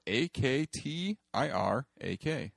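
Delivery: "A K T I R A K" said letter by letter; spelling it out. Shown as a demonstration. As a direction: spell "A K T I R A K" one letter at a time. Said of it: "which is spelled".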